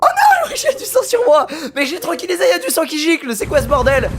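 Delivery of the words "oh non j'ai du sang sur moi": laugh-scream in mock horror; laughing panic. Real, not acted. Realizes blood on her. (cri aigu en riant)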